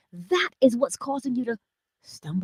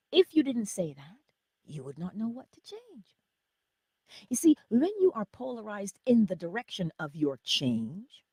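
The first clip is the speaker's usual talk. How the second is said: ordinary talk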